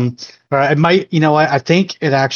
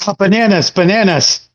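higher-pitched, hurried safe word; mock alarm; 6 semitones higher than usual. Captive calls the safe word as tentacles brush the face, mock alarm. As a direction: blurt it out in playful protest.

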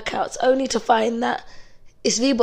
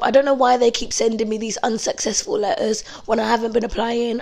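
ordinary talk

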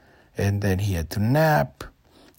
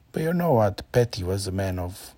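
ordinary delivery, nothing marked

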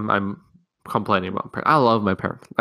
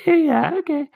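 high, squeaky fake-cheerful mom; mock sugary brush-off, much higher; hammed-up forced-smile reply; 5 dB louder, slower than usual. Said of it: "She'll just smile it off".

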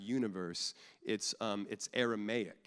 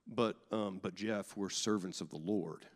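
lower, softer mock-solemn churchgoer; mock pious congregant; 5 semitones lower, 5 dB quieter than usual. Lightly mocking a pious churchgoer telling Jeff, lower. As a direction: play a stiff over-pious member. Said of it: "some of us in here are like".

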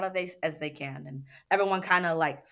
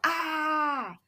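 mock yell; 10 semitones higher than usual. Shown as a demonstration. Facilitator showing a participant's anger move, mock yell. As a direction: give a small demo shout. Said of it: "I yell".

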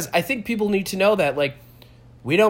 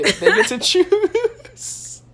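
laughing; 4 dB louder, much slower than usual. Real, not acted. Laughing while explaining idea.

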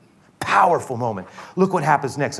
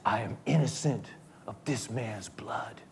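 soft, slowing to a near-whisper; hushed disclaimer; 2 semitones lower, 11 dB quieter than usual. Acted. Pilate washing his hands of Jesus, hushed and slow. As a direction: say it quietly, fading on the last words.

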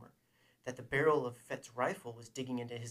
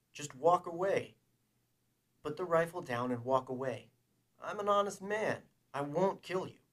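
higher-pitched, louder pleading; urgent plea; 5 semitones higher, 5 dB louder than usual. Acted. Man pleading with his enemy to walk away, urgent. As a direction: urge him insistently, pitch climbing. Han pleads for both to live.

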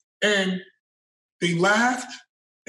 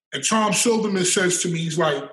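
ordinary talk, nothing marked

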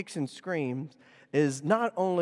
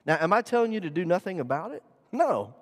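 usual speaking voice

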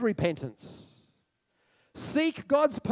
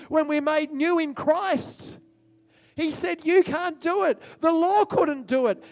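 full-force preaching; 7 semitones higher, 6 dB louder than usual. Acted. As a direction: preach it like a revival climax.

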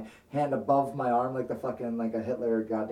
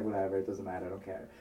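sulky dismissal; 6 semitones lower, 8 dB quieter, faster than usual. Retold or imitated. Hurt by her joke, a sullen brush-off. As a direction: lower and softer, fading into a sullen mutter. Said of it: "I was pissed".

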